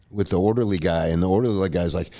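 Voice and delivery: ordinary delivery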